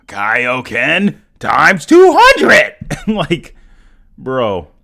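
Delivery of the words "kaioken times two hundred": loud, drawn-out mock power-up yell; imitated battle cry. Quoting a donor's message, hammed-up battle-cry shout. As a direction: shout it slowly, building to a huge finish. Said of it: "This dude literally said".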